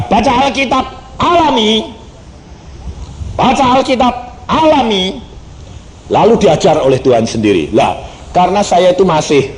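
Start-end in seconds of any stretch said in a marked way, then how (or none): 0.1-5.2 lightly voiced divine command, higher; a hint of God commanding gently. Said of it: "Lalu Tuhan berkata". (sedikit meniru suara Tuhan)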